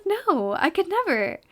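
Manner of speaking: mock horror. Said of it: "I'm like".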